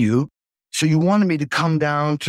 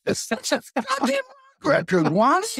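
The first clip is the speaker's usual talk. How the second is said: retold retort. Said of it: "I says".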